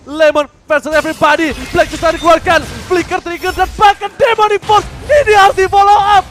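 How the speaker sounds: loud, fast esports casting; hyped play-by-play. Real, not acted. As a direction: call the teamfight at full pitch.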